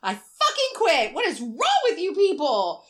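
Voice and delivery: high, loud, slow furious quitting; angry outburst. Retold or imitated. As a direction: yell it like you're done with everyone. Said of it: "the employee says".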